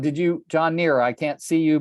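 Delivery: ordinary delivery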